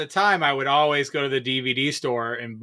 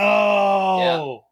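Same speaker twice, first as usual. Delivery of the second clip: shocked no; 6 semitones higher, 4 dB louder than usual. Real, not acted. Hearing the video store closed.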